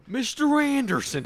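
high, drawn-out movie villain line; mock Agent Smith greeting. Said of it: "He'd be like".

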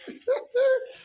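sudden high shriek of laughter; loud shrieking laugh. Real, not acted. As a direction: burst into a piercing laugh. Preacher bursts out at congregation.

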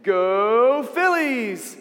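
loud, long drawn-out "Go"; crowd cheer. Shown as a demonstration. Storyteller teaches kids the Philistines' cue, cheering. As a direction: cheer like a fan in the stands. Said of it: "people cheering for them".

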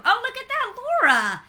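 high, loud delighted cheer; host cheers a player's right answer; excited cheer. Real, not acted. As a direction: cheer her on loudly. Host cheers correct guess.

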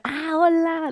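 high, eager greeting called from far off; a too-intense hello from across the room; overly intense hello. Retold or imitated. Greeting the stranger from far off, way too intense. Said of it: "como que le hice así como muy intenso".

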